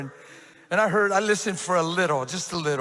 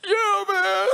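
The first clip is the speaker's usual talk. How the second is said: high, laughing-crying yeah man; laughing through tears; 12 semitones higher than usual. Real, not acted. The pastor retells the friend's answer, laughing high through tears.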